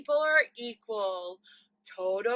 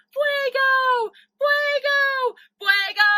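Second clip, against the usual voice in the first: loud, higher yell of the word Fuego; 10 semitones higher, 9 dB louder, slower than usual. Shown as a demonstration. Teacher demonstrating for the class a loud fire yell. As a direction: shout Fuego as if the house is on fire. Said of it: "Yeah, you can yell it".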